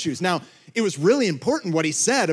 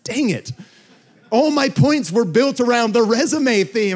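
high, loud comic dismay; worked-up retold frustration; exasperated outburst; 8 semitones higher, 6 dB louder than usual. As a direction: exclaim as your whole plan collapses. Retells dismay at sermon title falling apart.

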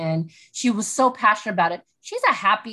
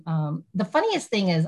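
ordinary talk, nothing marked

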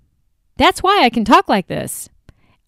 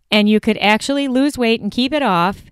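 ordinary talk, nothing marked

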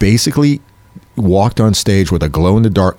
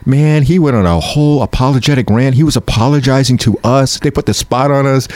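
mild agitation; 6 semitones higher than usual. Retold or imitated. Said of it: "he goes".

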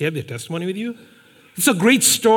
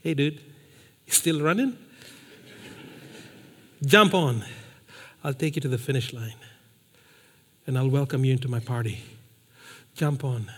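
gentle invitation; 7 semitones lower, 9 dB quieter than usual. Retold or imitated. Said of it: "it's like Christ came with his little buggy".

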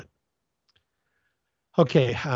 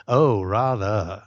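slow, drawn-out upper-class English "Oh, rather"; old British drawing-room comedy gentleman answering his wife; posh British reply; 4 semitones lower, much slower than usual. Pretend. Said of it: "like an old British drawing room comedy".

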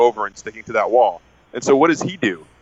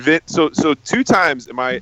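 normal talking voice

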